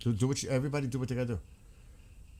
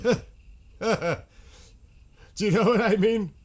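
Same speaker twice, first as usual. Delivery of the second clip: laughing exclaim; 12 semitones higher, 6 dB louder than usual. Real, not acted. Laughing emphatic rhetorical question.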